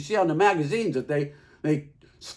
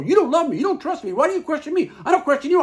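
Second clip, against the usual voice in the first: imitating a wife's tantrum, higher and louder; hysterical accusations; 9 semitones higher, 5 dB louder, faster than usual. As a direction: throw it like she's hurling dishes. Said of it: "carrying on something awful".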